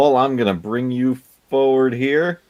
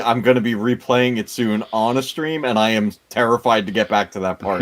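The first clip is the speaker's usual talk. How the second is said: ordinary delivery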